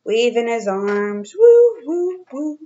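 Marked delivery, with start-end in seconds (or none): 1.4-2.6 high, loud playful monkey woo; monkey hoots. Acted. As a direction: hoot like a cheeky monkey. Imitating waving monkey.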